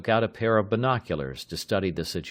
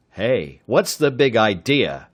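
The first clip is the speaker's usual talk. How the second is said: stammering; 3 semitones higher, 8 dB louder, slower than usual. A narrator's reading. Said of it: "the man stammered".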